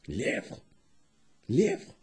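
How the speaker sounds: soft, low then lifted call; quiet calling. Acted. Hyena calls to the hare, quietly. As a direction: call out softly to someone.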